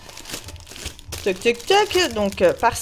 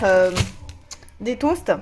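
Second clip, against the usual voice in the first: ordinary delivery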